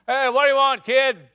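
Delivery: put-on a sentry yelling down at a boy; guard calling down. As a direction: shout down at the kid. Guard shouts down from wall.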